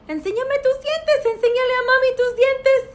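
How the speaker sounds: high, quick playful coaxing; excited coaxing. Real, not acted. Teacher asking a child to show teeth, excited coaxing.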